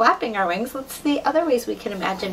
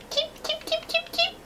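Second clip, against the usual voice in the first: very high light cheeps; chick cheeping; 4 dB quieter than usual. Pretend. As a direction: peep like a baby chick. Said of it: "bird noises".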